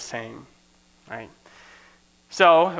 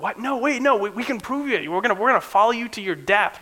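shocked protest; 8 semitones higher, 3 dB louder than usual. Acted. Disciples protesting Jesus' prediction.

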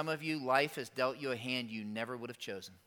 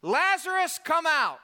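high, loud ringing call into the tomb; 13 semitones higher, 8 dB louder than usual. Retold or imitated. Jesus calling Lazarus out of the grave, loud high call. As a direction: call the dead man out by name. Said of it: "called in a loud voice".

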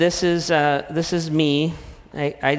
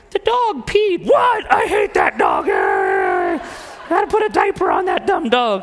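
hammed-up furious shout; exploding dad; 9 semitones higher than usual. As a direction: blow up at the dog. Acted blow-up over the dog.

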